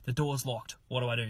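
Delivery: a hushed plea to a friend in a dark house; retold whisper. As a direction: keep it down, don't wake the parents. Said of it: "I'm whispering to her".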